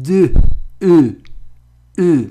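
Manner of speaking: او with lips fully rounded and pushed forward; rounded vowel demo. Shown as a demonstration. Teacher showing students lip rounding, او said with pursed lips. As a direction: purse the lips tight and sound او.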